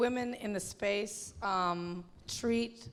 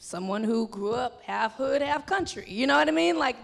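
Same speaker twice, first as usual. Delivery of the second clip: ordinary delivery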